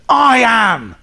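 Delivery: boastful self-praise. Retold or imitated. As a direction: ham up a pompous braggart repeating himself. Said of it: "he says".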